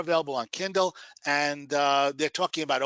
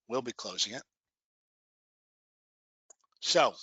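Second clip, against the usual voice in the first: guided meditation. Acted. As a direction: guide them gently into relaxation.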